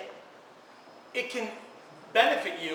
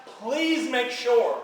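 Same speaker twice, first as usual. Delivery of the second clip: very slow emphasis; 2 semitones higher, much slower than usual. Real, not acted. Speaker closes with an appeal.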